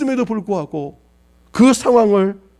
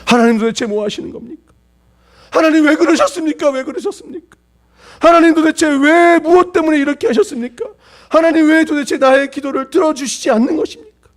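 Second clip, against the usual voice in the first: grieving parent rails at God, rising and pressing; resentful outcry; 6 semitones higher than usual. Retold or imitated. A parent crying out to God, resentful and pleading. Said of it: "하나님을 향하여 원망하는 거예요".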